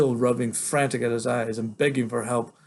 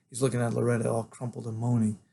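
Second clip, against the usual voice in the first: ordinary delivery, nothing marked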